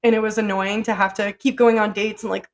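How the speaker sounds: normal talking voice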